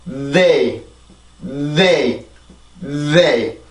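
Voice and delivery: "they" with a long voiced TH before the vowel; English TH onset. Shown as a demonstration. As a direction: let plenty of sound build in the TH, then rise into "they". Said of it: "이렇게 해서 발음을 해주세요". ("they"의 앞 자음 소리를 충분히 내는 발음)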